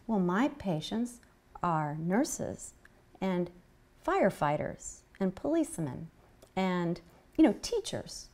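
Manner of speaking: hushed, halting retold list; imitating a whisperer. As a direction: quote him softly, pausing between items. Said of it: "he's whispering".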